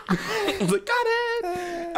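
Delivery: high squeaky laugh through the words; laughing squeal. Retold or imitated. Telling friends about coming out, laughing high. Retelling reacting to being stuck home.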